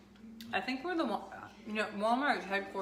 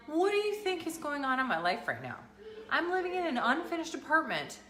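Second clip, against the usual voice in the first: exasperated husband; 10 semitones higher, 4 dB louder than usual. Retold or imitated. Husband's exasperated reply, retold.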